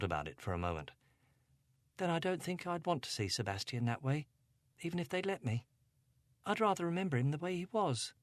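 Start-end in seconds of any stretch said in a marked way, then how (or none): 2.0-8.1 put-on higher, upset young girl voice; Angela, a hint of upset teen; teenage girl. A narrator's reading. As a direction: voice her as a troubled teenage girl.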